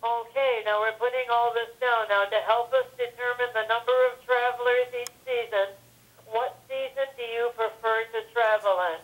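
ordinary talk, nothing marked

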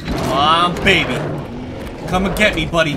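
taunting. Real, not acted. Taunting enemies in fight.

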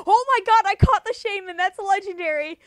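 loud, high, thrilled yell; excited shout. Real, not acted. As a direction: scream with real joy. Catches the wanted Pokemon.

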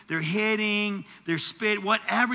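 ordinary talk, nothing marked

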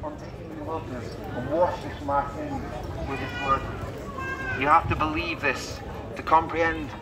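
usual speaking voice

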